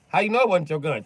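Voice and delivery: high, loud repeated question; prosecutor grilling. Acted. Prosecutor repeating the question, loud and high. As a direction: hammer the question again.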